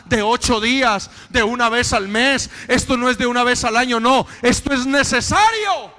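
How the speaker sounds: loud, ringing sermon voice; full-force preaching. Acted. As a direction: proclaim it to the congregation.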